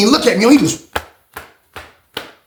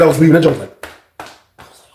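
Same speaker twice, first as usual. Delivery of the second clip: usual speaking voice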